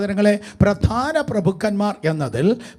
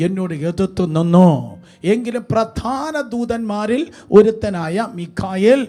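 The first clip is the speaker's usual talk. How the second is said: loud scripture reading; 4 semitones higher, 8 dB louder than usual. Real, not acted. Reading scripture aloud forcefully.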